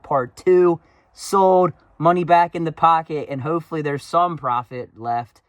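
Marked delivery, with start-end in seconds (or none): 0.0-3.3 excited. Real, not acted. Excited outro hype.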